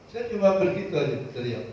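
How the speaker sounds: usual speaking voice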